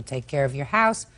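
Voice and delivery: ordinary talk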